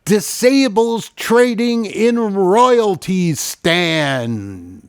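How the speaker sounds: high, loud, hammed-up headline read; over-the-top showman news intro; news announcer. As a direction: boom out the headline, stretching the words.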